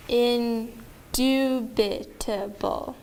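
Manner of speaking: INDUBITABLE very slow, each syllable separated; bounced syllable by syllable. Shown as a demonstration. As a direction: bounce out "indubitable" for a class to repeat. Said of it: "I'm going to bounce them".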